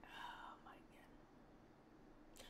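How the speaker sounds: slow, drawn-out whisper. Real, not acted. Streamer whispering slowly in disbelief.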